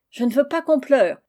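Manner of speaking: loud, high cry of protest; aunt stopping the weeping with a loud exclamation; exclaiming. A narrator's reading. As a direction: cut the tears short with a cry. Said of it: "s'écria madame de Montclair".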